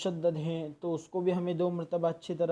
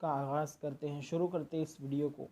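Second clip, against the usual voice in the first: normal talking voice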